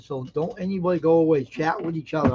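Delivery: usual speaking voice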